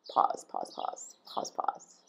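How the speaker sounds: fading, whispered pause, pause; drawn-out pause count. Shown as a demonstration. Acting out the awkward silence, fading whisper. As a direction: let each pause fade to nothing. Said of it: "and then a pause".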